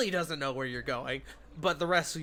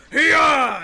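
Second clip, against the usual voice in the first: loud, high "hee-yah" to horses; explosive cart-driver's cry; driver's shout; 9 semitones higher, 16 dB louder than usual. Acted. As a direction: whip the horses on with a yell.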